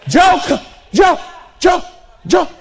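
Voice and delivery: high, excited calling of the son's name; joyful calling out. Retold or imitated. Father spotting his son coming home, overjoyed. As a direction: cry out with joy at the sight of him.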